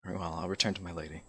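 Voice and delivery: soft, controlled threat; quiet and stern. Acted. Elrin warns the two about his lady, quietly.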